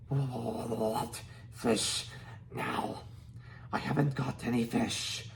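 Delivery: rough, strange dragon-language voice; Dragonese. A narrator's reading. Hiccup answers Toothless's demand in Dragonese. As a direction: answer as if speaking dragon tongue. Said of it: "said Hiccup in Dragonese".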